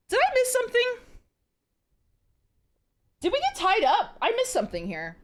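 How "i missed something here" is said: normal talking voice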